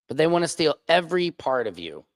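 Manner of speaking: usual speaking voice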